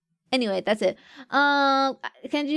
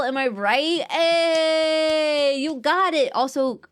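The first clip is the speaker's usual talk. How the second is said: normal talking voice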